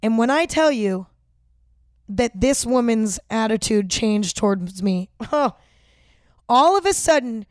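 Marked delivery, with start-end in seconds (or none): none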